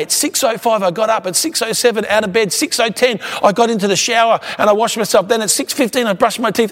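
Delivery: high, unvarying minute-by-minute recital; lightly acted high-pitched itemizing; imitating loud talker. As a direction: reel off a schedule, mimicking an over-precise talker. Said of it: "talk at one volume loud".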